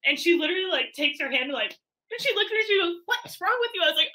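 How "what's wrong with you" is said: high-pitched mock reproach; imitated scolding sister. Embarrassed sister to the woman yelling in the theater, high mock scolding.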